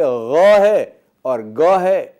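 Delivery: غ gargled, then a hard گ, slow and loud; contrasting sounds. Shown as a demonstration. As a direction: set غ against گ slowly. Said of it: "دشواری پیدا ہوتی ہے". (غ اور گ کا فرق)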